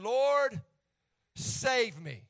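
usual speaking voice

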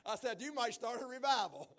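laughing through the words; can't stop laughing as he tells it. Real, not acted. Pastor retelling his joke to Mike, breaking into laughter.

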